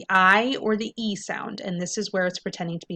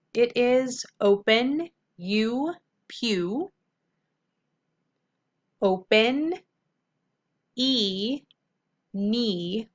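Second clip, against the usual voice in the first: slow teacher demo; 3 semitones higher, 3 dB louder, much slower than usual. Shown as a demonstration. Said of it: "let's look at our first syllable".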